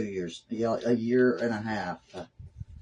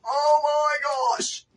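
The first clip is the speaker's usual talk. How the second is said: very high, very slow, drawn-out wail; shocked wail; 4 dB louder, much slower than usual. Real, not acted. Crafter reacting live to the ruined piece, stunned wail. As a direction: let it rise into a stunned howl. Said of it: "the girl has gone mental".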